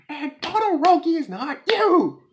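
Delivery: high, loud indignant retort; defiant outburst. Retold or imitated. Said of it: "And then Meteor is like".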